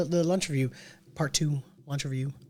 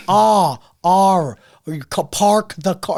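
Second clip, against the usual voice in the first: loud, laboured Boston attempts at R; Boston accent; 4 semitones higher, 9 dB louder, much slower than usual. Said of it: "you can't pronounce R's because of".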